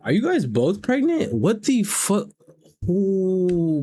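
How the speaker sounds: high-pitched, astonished outburst; shocked disbelief. Real, not acted. Learning both women are pregnant, shocked and high-pitched. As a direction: react in stunned disbelief, voice shooting up.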